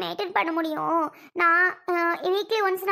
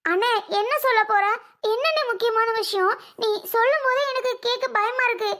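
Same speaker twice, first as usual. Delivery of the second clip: anxious; 4 semitones higher than usual. Acted. Sister fears bad news from brother.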